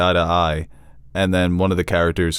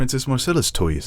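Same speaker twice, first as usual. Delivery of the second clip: put-on Ned's accent; 4 semitones higher than usual. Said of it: "in his accent".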